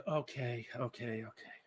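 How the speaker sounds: put-on boy caving in to his sister, soft and reluctant. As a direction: sigh it out, giving in. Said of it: "with some reluctance".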